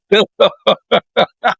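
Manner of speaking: high giggle at his own blunder; embarrassed laugh. Real, not acted. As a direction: laugh it off, a little sheepish.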